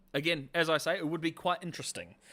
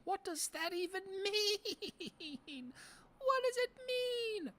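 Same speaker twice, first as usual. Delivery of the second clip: high-pitched confused voice; 6 dB quieter, slower than usual. Pretend. Baffled by Discord Nitro.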